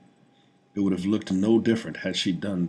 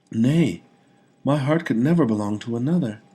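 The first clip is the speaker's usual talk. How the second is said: with conviction; 6 semitones higher, 8 dB louder than usual. A narrator's reading. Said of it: "She spoke with conviction".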